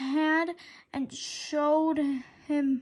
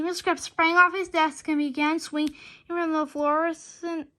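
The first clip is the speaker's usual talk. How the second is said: ordinary delivery, nothing marked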